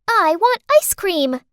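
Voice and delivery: put-on high, loud little-girl voice, drawn out; child's eager demand. Little girl asks the narrator for ice cream, eager demand. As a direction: whine it like a kid wanting a treat.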